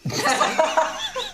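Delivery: sudden high shocked laugh. Real, not acted. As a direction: burst out laughing. Shocked at rude remark.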